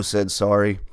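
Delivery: ordinary delivery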